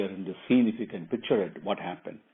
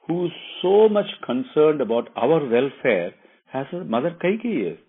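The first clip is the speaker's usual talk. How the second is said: raised, emphatic, louder wifely voice; earnest wife Sita; 5 semitones higher, 5 dB louder than usual. Acted. Wife assuring Rama of Mother Kaikeyi's love, earnest and louder. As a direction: assure him with ringing conviction.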